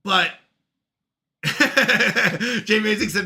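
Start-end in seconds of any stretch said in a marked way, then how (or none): 1.4-2.6 loud, higher burst of laughter. Real, not acted. Streamer amused by friend's chat message, laughing.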